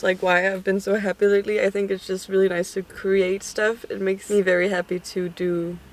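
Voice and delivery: ordinary talk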